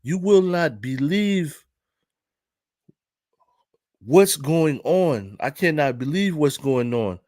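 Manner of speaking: put-on slow, deliberate African-accented delivery. Parking lot guy amazed to his buddy, imitated African accent. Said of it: "I'm trying to do his voice".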